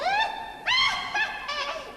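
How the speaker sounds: high witch cackle. Acted. Witch laughing at the apprentice's silly mistake, high cackle.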